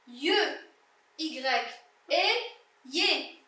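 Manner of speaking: letter by letter, then the blended syllable; teacher's spaced-out phonics drill; syllabic reading. Shown as a demonstration. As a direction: sound out each item separately with clear pauses. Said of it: "Donc, lecture syllabique".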